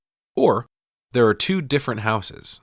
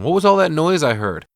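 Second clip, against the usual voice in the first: higher, louder retold question; calling from upstairs; 7 semitones higher, 6 dB louder than usual. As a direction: call it out as if from upstairs. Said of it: "we hear Max upstairs going".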